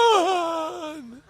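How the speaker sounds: long, high, drawn-out yell; Kirk's Khan yell. Retold or imitated. Quoting the famous Star Trek movie cry, long high yell. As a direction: stretch the name out in one sustained high cry.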